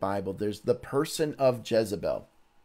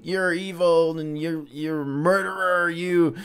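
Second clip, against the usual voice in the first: mock accusing queen; 5 semitones higher than usual. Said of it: "the queen saying".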